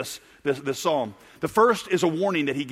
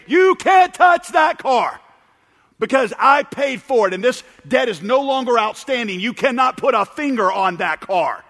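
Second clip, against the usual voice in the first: loud voice; 6 semitones higher, 6 dB louder than usual. Acted. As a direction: shout them off, then keep insisting loudly. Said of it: "with a loud voice, you say".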